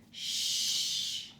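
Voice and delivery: sharp unvoiced hush from off; shushing. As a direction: hush the noisy bear. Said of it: "said a voice".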